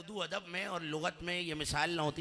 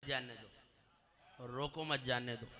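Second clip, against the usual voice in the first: quiet, falling "रोको मत", then "जानने दो"; pause after रोको मत; 5 semitones lower, 8 dB quieter than usual. Shown as a demonstration. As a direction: say रोको मत softly as one phrase, then drop जानने दो. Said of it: "लहजे से". (धीमे "रोको मत", फिर "जानने दो")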